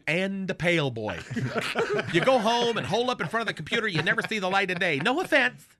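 normal talking voice

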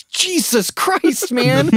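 very high, slow mock groan of disbelief; squeaky fed-up lawyer; exasperated lawyer. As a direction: stretch it out way up high. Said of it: "He goes".

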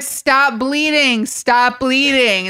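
hammed-up panic; mock frantic crowd. Guests order the victim, slow mock urgency. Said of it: "And everyone's like".

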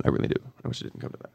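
choked, barely voiced whisper; real tears held back, fading out; near tears. Real, not acted. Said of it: "you're gonna make me cry".

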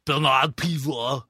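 Bernard Pivot with exaggerated French pronunciation, slow and drawn out; overpronounced French name. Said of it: "he overpronounces it".

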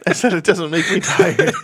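laughing. Real, not acted. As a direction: talk through a laugh. Laughing through clarification.

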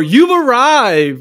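slow, drawn-out grand welcome; grand greeting. Shown as a demonstration. Retreat host rehearses greeting guests, slow and grand. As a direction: announce the arrival slowly, like a host. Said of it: "or, like, or".

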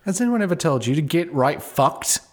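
high, put-on British insult; Cockney British accent. Shown as a demonstration. Corrected player hitting back at host, high British accent. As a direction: swear back in mock Cockney. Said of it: "yours is Cockney British".

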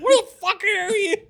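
high shouted, laughing boss yell; mock angry boss. Retold boss yelling down the phone, laughing. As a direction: yell it like a furious boss, cracking up.

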